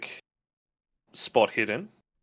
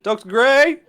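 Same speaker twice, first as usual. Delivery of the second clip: high, loud calling voice; host calling for the doctor, raised call; 13 semitones higher, 9 dB louder than usual. Acted. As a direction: call across the house for him. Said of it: "he kinda goes like".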